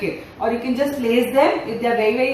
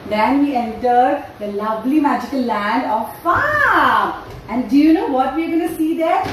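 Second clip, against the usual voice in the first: put-on reading to a toddler; 4 semitones higher than usual. Animated storytelling for little kids.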